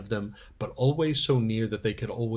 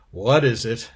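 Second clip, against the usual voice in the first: louder, blurted question; too loud; 7 dB louder than usual. Acted. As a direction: ask it out loud without thinking. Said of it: "Hush, not so loud".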